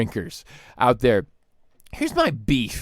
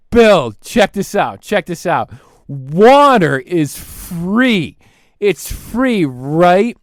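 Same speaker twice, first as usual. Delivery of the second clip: excited pitch; 3 semitones higher, 7 dB louder, slower than usual. Retold or imitated. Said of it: "He's like".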